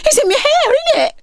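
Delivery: very high, frantic; panicked girl. Acted. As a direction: freak out. Girl panicking about worm.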